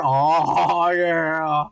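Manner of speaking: low, long-held oh yeah; a long, low holler; drawn-out catchphrase. Pretend. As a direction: stretch both words out, low. His drawn-out catchphrase again.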